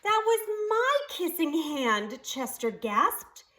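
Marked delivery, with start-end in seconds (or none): 0.0-2.2 put-on high, slow, hurt child's cry; a little raccoon's heart breaking; saddest voice. A narrator's reading. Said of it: "in the saddest voice his mother had ever heard".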